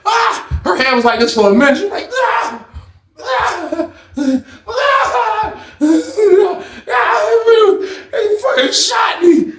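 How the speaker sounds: very high repeated screams, slow; traumatized officer screaming into the walkie-talkie; hysterical screams. Retold or imitated. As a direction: scream in panic. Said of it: "She's like".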